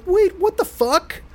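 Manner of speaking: high, slow, squeaky disbelief; stunned surprise. Retold or imitated. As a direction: stretch it out, thrown off. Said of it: "And I'm like".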